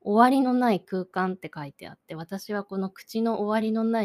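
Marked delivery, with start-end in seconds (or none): none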